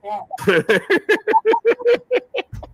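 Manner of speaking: squealing high cackle; real extended laughter; long high laugh. Real, not acted. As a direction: cackle hard at the joke. Laughs at own roast.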